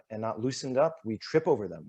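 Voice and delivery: ordinary talk, nothing marked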